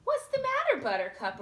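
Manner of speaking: high sweet concern; concerned question. Acted. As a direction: coax the scared horse. Aurora asks her frightened horse.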